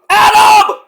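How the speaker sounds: high, strained mock scream; Roddy screaming. Wrestler screaming at everybody, imitated scream. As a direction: scream the name as if furious. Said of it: "he's screaming at everybody".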